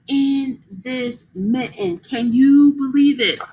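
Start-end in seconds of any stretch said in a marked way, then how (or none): none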